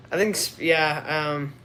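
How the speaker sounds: usual speaking voice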